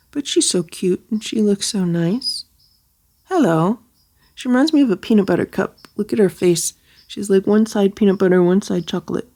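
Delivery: ordinary delivery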